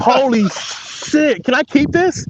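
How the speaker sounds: stunned by the fish; excited. Real, not acted. Catches first big walleye.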